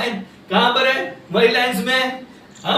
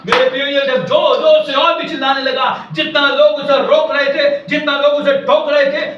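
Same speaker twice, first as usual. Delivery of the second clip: ordinary delivery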